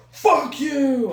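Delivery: high, loud imitated yell; mock furious shout, high-pitched; retold yell. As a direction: yell it out like the angry guy in the story. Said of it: "he's just yelling and yelling".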